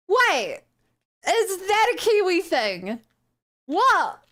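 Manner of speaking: high-pitched, startled questioning; real disbelief. Real, not acted. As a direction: blurt it out in genuine surprise. Stunned to learn togs is Kiwi.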